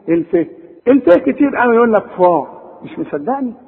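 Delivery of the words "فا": فا with a dark, backed "aa"; wrongly heavy Fa. Shown as a demonstration. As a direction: thicken فا into "faw". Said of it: "كل دي غلط".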